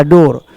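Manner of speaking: डोर with an "o" vowel; or sound. Shown as a demonstration. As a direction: say डोर as "dor", one syllable. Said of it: "इसका और बदल गया".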